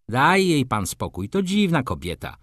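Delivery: ordinary delivery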